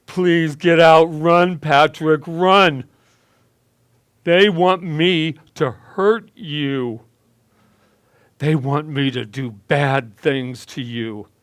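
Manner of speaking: a tormented father screaming at his son to flee; slurred scream. A narrator's reading. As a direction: scream the warning, words dragging and slurred. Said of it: "In a slowed, slurred speech, he screamed out".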